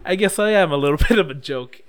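loud, high retold quote through laughter; laughing along. Imitating the hero as he joins her laughter, amused and loud. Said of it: "he just starts laughing too".

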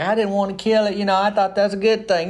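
put-on high, whiny little boy voice; sad little boy. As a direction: plead like a sorry seven-year-old. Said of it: "the little sad boy, he's like".

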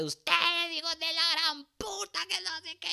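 high, screeched mock tirade; mock-furious high-pitched scream; screaming old lady. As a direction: imitate an enraged mother shrieking insults from her doorway. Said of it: "hay una señora gritando".

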